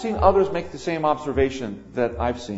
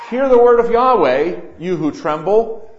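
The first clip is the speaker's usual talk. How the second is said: preaching at full force; 3 semitones higher, 11 dB louder than usual. Acted. Preacher exhorting congregation.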